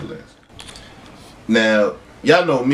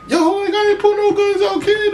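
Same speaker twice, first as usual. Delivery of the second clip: mock high whiny voice. Retold fight, man denying guns.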